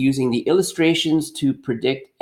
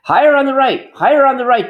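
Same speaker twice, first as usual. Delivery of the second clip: impatient order, higher and louder; demanding mayor; 14 semitones higher, 5 dB louder, faster than usual. Acted. Mayor ordering Chip to raise the banner, loud and impatient. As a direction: bark it up at the worker like an impatient mayor. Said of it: "demanded the mayor".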